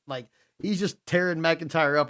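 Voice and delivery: normal talking voice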